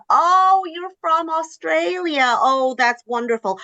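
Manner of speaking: high-pitched, drawn-out gushing; mock-thrilled stranger reacting; imitated delight. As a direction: gush as if charmed by a foreigner. Acted reaction of delighted New Yorkers.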